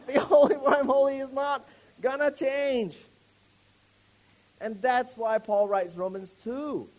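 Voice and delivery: loud, slow, proclaiming; full-force preaching. Real, not acted. Preacher declaring God's holiness will never change.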